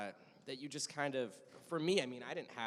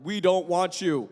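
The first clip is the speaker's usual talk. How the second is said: loud, slow mock jeer; booming put-on voice of hostile commenters; jeering online commenters; 6 semitones higher, 15 dB louder, slower than usual. Actor mimicking online comments, loudly rejecting. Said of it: "I go read the comments online".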